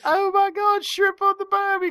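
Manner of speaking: put-on high, laughing Australian accent; high mocking Australian voice. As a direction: ham up an Aussie "shrimp on the barbie". Mocking Aussie accent after laugh.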